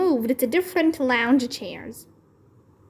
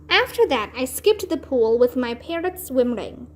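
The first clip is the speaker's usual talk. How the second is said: plain reading voice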